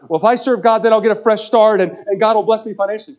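mock the people who bargain with God; mock naive believer.

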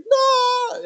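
sudden high shouted no; panicked scream. Retold or imitated. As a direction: yell it like a terrified kid thrown in the lake. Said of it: "But I screamed bloody murder".

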